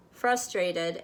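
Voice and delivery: plain reading voice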